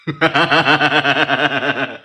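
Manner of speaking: a loud burst of laughter; laughing out loud, real and loud. Real, not acted. Streamer laughs at teasing his aunt, loud laugh.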